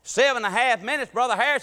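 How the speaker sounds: loud comic telling-off; mock scolding. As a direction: scold the preacher, playing it up. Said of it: "scolded him".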